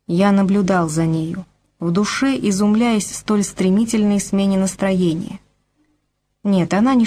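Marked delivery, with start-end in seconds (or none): none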